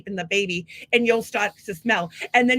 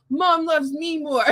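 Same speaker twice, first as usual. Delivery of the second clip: drawn out, higher-pitched mock twin; taunting twin; 5 semitones higher, slower than usual. Doll twin needling its brother Wes, mock taunting. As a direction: tease as a made-up twin, stretching the words. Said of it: "the twin will be like".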